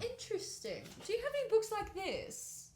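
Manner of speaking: high-pitched, inquisitive character voice; curious customer in character. Acted. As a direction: ask the shopkeeper in a high, curious voice. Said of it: "I'd be like".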